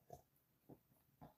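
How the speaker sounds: breathless whisper of wonder; whispered awe. Real, not acted. Sipping tea alone, stunned how good it is, whispered. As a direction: whisper it like you can't believe it.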